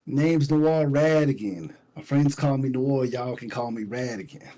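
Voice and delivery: ordinary talk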